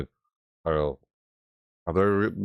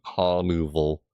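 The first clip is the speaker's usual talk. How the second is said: ordinary talk, nothing marked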